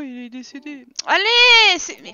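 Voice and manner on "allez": frustrated yell. Real, not acted. Frustrated, game bugging.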